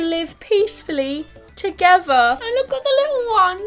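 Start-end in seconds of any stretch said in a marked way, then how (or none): 2.4-3.6 touched, teary. Real, not acted. Touched by the cute ending.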